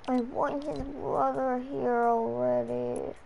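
soft, slow acted moan; weary groaning. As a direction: groan as if too tired to get up. Pretends being woken by crying.

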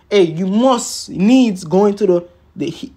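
usual speaking voice

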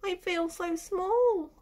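a hint of the tiny snail; lightly voiced tiny snail in awe. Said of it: "the snail said".

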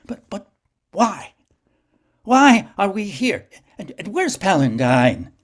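high, loud, stammering fear; a frightened man in a nightmare; panicked questions. Acted. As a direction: grasp for answers in terror.

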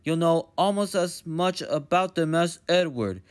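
steady, lecturing director voice; stern fat director. Acted. Director teaching Thomas about trucks, firm and lecturing. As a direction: lecture the little engine firmly.

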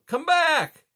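loud, high shout; loud high call. A narrator's reading. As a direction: yell after him down the path. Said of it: "Rye called".

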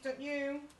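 plain reading voice

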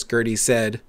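plain reading voice

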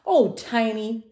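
slow, high, loud motherly sigh; mom's exasperated sigh. A narrator's reading. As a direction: draw it out as a sighing mom. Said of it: "sighed his mom".

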